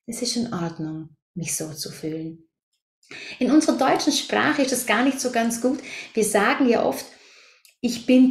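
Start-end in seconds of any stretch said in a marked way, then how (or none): 0.1-2.5 softer, gentle reassurance to yourself; soothing self-talk. Shown as a demonstration. Coach shows the group what to tell themselves, softly and gently. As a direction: say it quietly, as comfort. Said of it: "indem ihr einfach euch nur immer wieder sagt".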